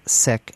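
"Sikh" with a short i vowel, like sick; short-i pronunciation. Shown as a demonstration. Host citing guests' pronunciation, short-i "Sikh". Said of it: "pronounced it".

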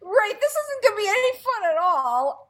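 very high mock complaint; whining reluctant student. Teacher echoing students who resist chamber music, high mock whine. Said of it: "kick and scream saying".

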